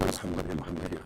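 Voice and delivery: a hint of low, hushed ghostly call; eerie divine voice. Angel's voice calling the prophet, lightly hushed and low. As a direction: call out softly like a distant spirit. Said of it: "The voice goes".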